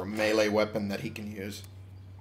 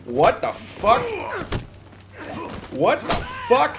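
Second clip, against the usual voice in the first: shocked disbelief. Real, not acted. Sudden attack by enemy.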